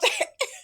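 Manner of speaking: a sharp, very high laugh; high squeal of laughter. Real, not acted. Laughing as the villain corners Evan. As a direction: burst out laughing in delight.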